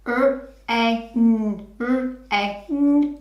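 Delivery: R-A-N sounded out letter by letter; sounding out words. Shown as a demonstration. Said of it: "Let's read it together".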